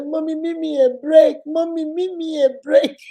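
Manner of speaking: put-on high, childish pleading for a break; mother mimicking her toddler's whiny plea; imitating little daughter. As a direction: plead like a four-year-old, fading to a whisper. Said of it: "She say".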